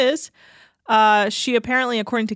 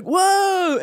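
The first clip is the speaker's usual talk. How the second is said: awed dude; 10 semitones higher, 4 dB louder than usual. Retold or imitated. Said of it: "And they'd be like".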